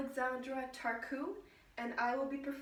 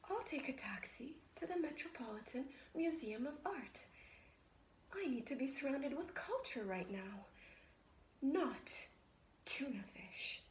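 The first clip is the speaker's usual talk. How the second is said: soft, hushed, fragile composure; subdued resolve; 10 dB quieter than usual. Acted. Woman deciding to seek culture after fleeing, hushed and subdued. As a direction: bring it right down to a near murmur.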